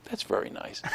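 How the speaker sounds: very soft, near-whispered brush-off; placating mother. Retold or imitated. Mother humors her insistent son, softly dismissive. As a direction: imitate a parent humoring a child, hushed.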